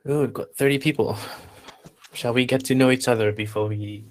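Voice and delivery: ordinary talk, nothing marked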